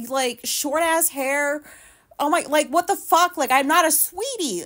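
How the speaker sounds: ranting loud at her camera, fed up; real loud rant. Real, not acted. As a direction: vent at full volume about being called sweetie. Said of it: "She doesn't like it when I get loud".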